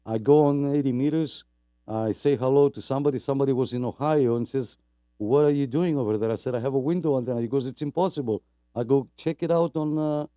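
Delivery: ordinary talk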